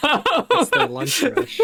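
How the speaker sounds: laughing. Real, not acted. Joking, laughing.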